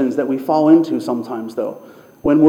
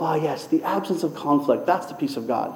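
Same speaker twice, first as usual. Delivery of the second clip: softer, settled inner musing; musing to yourself; 8 dB quieter than usual. Retold or imitated. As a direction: say it as a contented private thought. And you think to yourself.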